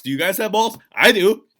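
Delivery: high, very loud mock bellow; imitated bold challenge. Contestant in exit interview, imitated loud dare. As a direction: shout it as a cocky dare.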